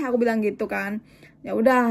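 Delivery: normal talking voice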